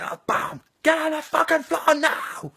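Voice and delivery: high, strained, drawn-out yell; armed police shout. Acted. Armed response to a squeaky gun, screamed command. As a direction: scream the order at a suspect. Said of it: "the arm responds".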